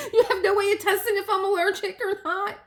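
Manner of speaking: high, loud, laughing disbelief; alarmed protest. Retold or imitated. Patient challenging technician about allergy testing, alarmed protest. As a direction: protest at the top of your range.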